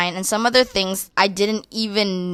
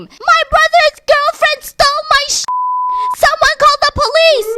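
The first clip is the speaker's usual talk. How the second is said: high, loud mock outraged scream; hammed-up scream; 7 dB louder than usual. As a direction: scream it out for the whole park to hear. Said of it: "I would scream".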